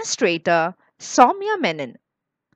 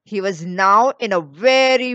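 usual speaking voice

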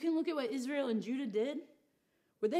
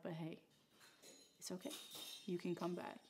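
soft, gentle reassurance, much softer; hushed forgiving voice of God; tender God; 3 semitones lower, 12 dB quieter than usual. Acted. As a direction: soothe a wayward child.

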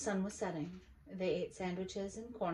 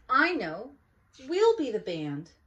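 much louder sudden idea; eager child; 6 semitones higher, 11 dB louder than usual. Acted. Olivia proposing to be the band, loud and eager. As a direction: announce the brilliant plan.

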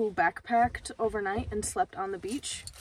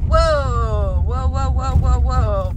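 loud, startled whoas; surprised whoa; 13 dB louder, slower than usual. Real, not acted. Vlogger sees the full lot at dawn, loud startled whoas. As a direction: blurt out shocked whoas at the packed lot.

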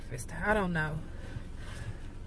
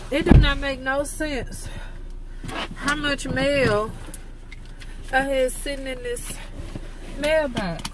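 exasperated; 6 semitones higher than usual. Real, not acted. Exasperated at full mailbox.

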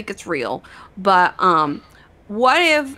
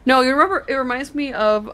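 normal talking voice

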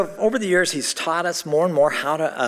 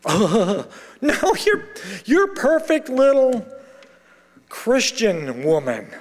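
much higher, mocking singsong; sarcastic mock reply; 6 semitones higher, slower than usual. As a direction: sneer it sarcastically. Sarcastic retort to the mentor.

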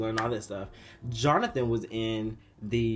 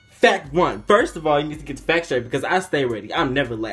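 louder, rapid, fired-up lecture; heated callout; 7 dB louder than usual. Retold or imitated. On the bus, telling the boy off in a loud heated rant. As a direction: set him straight, fast and loud.

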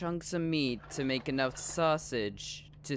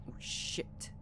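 hushed whispered curse; whispering; 9 dB quieter than usual. Real, not acted. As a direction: whisper it so nobody hears. Trapped near the monster.

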